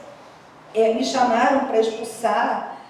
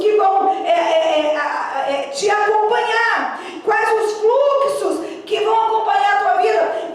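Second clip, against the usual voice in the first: high-pitched, driving sermon delivery; impassioned preaching; 8 semitones higher than usual. Acted. Preacher warning the congregation about careless words, impassioned. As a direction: preach it high and insistent, punching each challenge.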